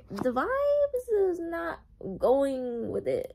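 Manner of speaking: slow, high-pitched mock complaint about the vibes; drawn-out complaint. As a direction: stretch it out in playful disapproval. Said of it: "I'm like".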